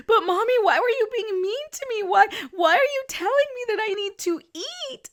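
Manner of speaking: put-on high, loud, whining kid voice; imitating a hangry daughter complaining to mom; whiny little girl. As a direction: whine it like an upset six-year-old. Mom voicing her whining daughter.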